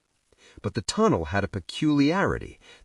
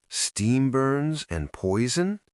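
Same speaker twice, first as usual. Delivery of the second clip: slow, high disbelieving question; incredulous question; 6 semitones higher, slower than usual. A narrator's reading. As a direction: ask it as if it can't be true. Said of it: "Evelyn asked".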